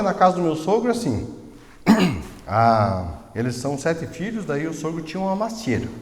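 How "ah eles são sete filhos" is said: normal talking voice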